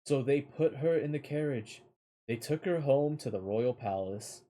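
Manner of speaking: plain reading voice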